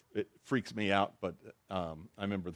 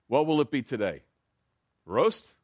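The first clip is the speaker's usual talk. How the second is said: butcher voice; 3 semitones higher, 6 dB louder than usual. Acted. Butcher asking dog's order.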